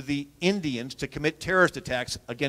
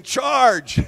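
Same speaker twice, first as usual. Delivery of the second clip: retold battle cry; 8 semitones higher, 4 dB louder than usual. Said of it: "waving his coat saying".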